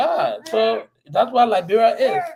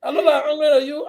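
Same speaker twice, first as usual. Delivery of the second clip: mock reply; 7 semitones higher, 4 dB louder than usual. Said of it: "putting your mouth on Paul Kennedy".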